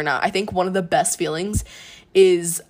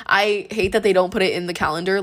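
usual speaking voice

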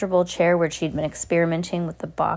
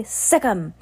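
sharp, higher-pitched attack order; sharp command; 4 semitones higher than usual. A narrator's reading. Wife sending dog after the witches, sharp command. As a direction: set the dog on them. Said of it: "the woman said sharply".

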